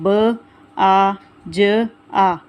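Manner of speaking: ब आ ज आ said very slowly, letter by letter; spelling out. Shown as a demonstration. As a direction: break the word into its letters slowly. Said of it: "बाजा के जो तोड़ हैं वो देखें". (अक्षर अलग-अलग धीरे-धीरे बोलना)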